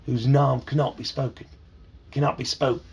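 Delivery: a light British accent, "nom" for name; lightly voiced British pronunciation. Shown as a demonstration. Dad explaining the line to his kids, light British accent. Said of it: "It's in a British accent".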